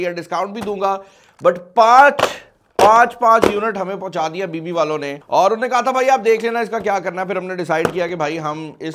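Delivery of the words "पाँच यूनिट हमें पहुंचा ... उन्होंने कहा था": ordinary talk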